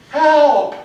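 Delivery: loud, high, strange-voiced yell; a big comic cry for help; really crying help. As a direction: scream "Help!" with a laugh in it. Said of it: "And the character really goes".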